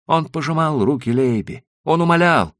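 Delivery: usual speaking voice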